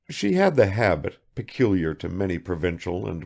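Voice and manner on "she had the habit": ordinary delivery, nothing marked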